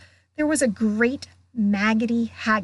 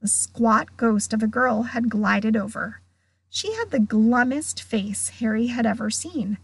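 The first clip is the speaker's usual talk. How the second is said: ordinary delivery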